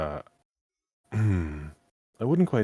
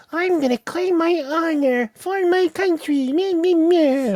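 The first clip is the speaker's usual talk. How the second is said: mocking blah blah; 8 dB louder than usual. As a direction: parrot a pompous hero with scorn. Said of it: "Something that isn't to the effect of".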